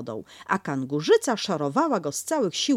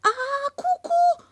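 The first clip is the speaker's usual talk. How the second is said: tiny squeaky piglet voice, much higher; Piglet's loudest peep; 5 dB louder than usual. Acted. Piglet calling from the pocket, much higher squeak. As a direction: Piglet squeaking as loud as he can.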